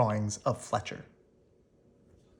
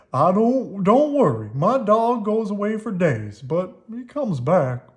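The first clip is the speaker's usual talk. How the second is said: put-on nervous old man; 6 semitones higher, 7 dB louder than usual. A narrator's reading. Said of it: "said Gwen".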